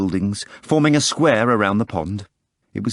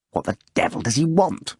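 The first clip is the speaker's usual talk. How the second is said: irritated thought; 7 semitones higher than usual. Acted. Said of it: "wondered Holmeless, frowning".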